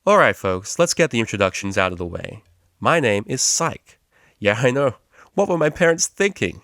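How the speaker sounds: loud, upbeat lecturer greeting the class; cheery tutor. Acted. Tutor Psych introduces himself, loud and chirpy.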